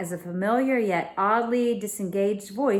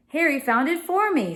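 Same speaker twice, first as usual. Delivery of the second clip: put-on higher girl's voice; Emma voice; 9 semitones higher, 4 dB louder than usual. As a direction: speak up as the young girl.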